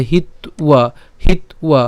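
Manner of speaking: "হিত্বা" broken as hit, then ua; split pronunciation. Shown as a demonstration. Teacher shows antastha ba as u, "হিত্বা" as hit-ua. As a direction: close on t in "হিত্বা", then glide ua. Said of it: "তার উচ্চারণ কেমন হবে?".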